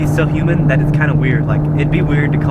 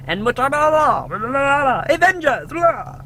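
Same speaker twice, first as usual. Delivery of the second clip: high mock Spanish announcer; lightly hammed Spanish trailer gibberish; Spanish movie trailer voice; 12 semitones higher, 4 dB quieter, slower than usual. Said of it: "Imagine how he sounds in Spanish".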